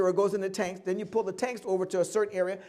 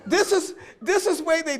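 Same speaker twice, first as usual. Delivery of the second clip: laughing protest; 7 semitones higher, 6 dB louder than usual. Real, not acted. Laughing while defending story.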